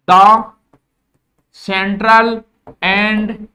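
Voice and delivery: each English word dropped slowly and loudly; slow savoured English. Shown as a demonstration. Teacher savouring an English book title for the class, slow and loud. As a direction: relish every word, one at a time. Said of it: "ऐसे English बोलने में स्वाद आता है".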